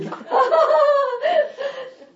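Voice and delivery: high, loud burst of laughter. Real, not acted. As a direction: laugh it out, tickled.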